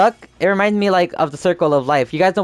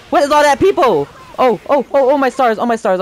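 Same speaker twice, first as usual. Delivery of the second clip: startled panic; 7 semitones higher, 3 dB louder than usual. Real, not acted. Panicked reaction to game chaos.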